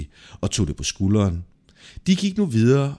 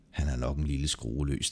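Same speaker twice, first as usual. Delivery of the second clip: low, soft aside to himself; hushed, low musing; private thought; 6 semitones lower, 9 dB quieter than usual. Acted. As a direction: think it aloud quietly. Private thought about Peter's odd sharing.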